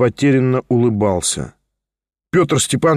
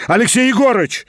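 calling out; 7 semitones higher than usual. A narrator's reading. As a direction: call the servant across the house. Calling the servant.

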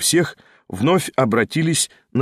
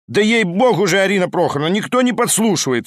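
high, pleading exasperation; agitated protest; 7 semitones higher than usual. A narrator's reading. Lyamshin swears to the hostess nobody eavesdrops, agitated protest. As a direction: protest as if jumping to your feet. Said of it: "вскочил Лямшин".

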